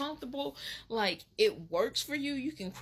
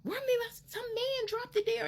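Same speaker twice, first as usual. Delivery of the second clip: high mocking thief voice; 13 semitones higher than usual. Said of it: "And he went running like".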